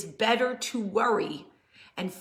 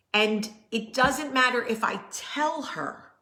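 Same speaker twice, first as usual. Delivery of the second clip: ordinary talk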